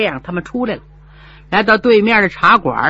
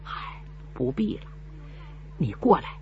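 faint dying whisper; 7 semitones lower, 14 dB quieter than usual. Acted. Dying mother whispers to son.

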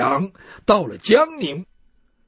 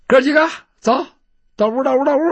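higher, raised voice herding friends inside; brisk summons to the brothers; calling companions over; 5 semitones higher, 4 dB louder, much faster than usual. Acted. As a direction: urge the group along, pitched up.

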